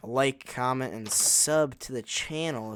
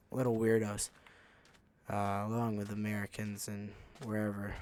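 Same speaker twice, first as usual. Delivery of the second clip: usual speaking voice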